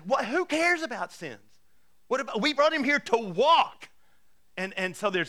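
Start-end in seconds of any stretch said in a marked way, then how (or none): 0.0-3.9 high, loud, incredulous outburst; exasperated friends objecting, pitched way up; mock outrage, voice jumping high; indignant protest. Friends on the roof protest forgiveness.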